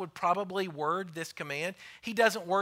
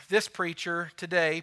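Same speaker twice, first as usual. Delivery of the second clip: normal talking voice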